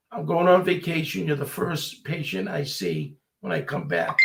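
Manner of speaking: ordinary talk, nothing marked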